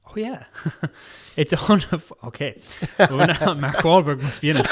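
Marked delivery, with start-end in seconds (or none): none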